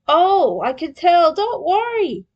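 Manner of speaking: loud, high mock reassurance; sarcastic comfort; teasing. Leo teasing Krang, loudly mock-reassuring.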